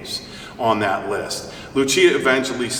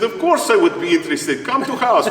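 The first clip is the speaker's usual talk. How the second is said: hammed-up Russian accent, rising higher; mock Russian accent; 9 semitones higher, 3 dB louder than usual. Retelling neighbor's reply on the phone, in a playful Russian accent. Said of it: "she doesn't talk like that, I just threw that in".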